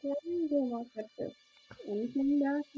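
talking through water; garbled, like under water; underwater. Real, not acted. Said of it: "you sounded like you were talking through water".

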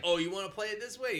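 high, loud mock challenge; mock threatening comeback. Alien answering the insults, mock challenge. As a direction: rise up high, imitating someone provoked.

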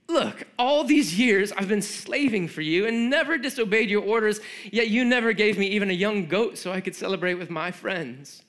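put-on older son protests to his father, loud and bitter; resentful older son. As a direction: vent years of grievance at his father. Angry older brother confronts father.

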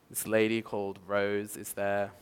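ordinary talk

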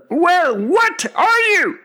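very high, loud bird screech; impatient screeching pigeon; demanding pigeon. A narrator's reading. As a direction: demand an answer in a shriek. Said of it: "said the pigeon".

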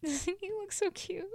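high, soft and slow gushing; high soft cooing aww. Real, not acted. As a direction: coo over the photo. Gushing over sweet photo.

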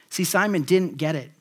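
ordinary delivery

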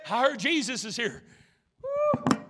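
ordinary delivery

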